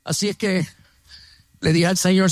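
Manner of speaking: ordinary talk, nothing marked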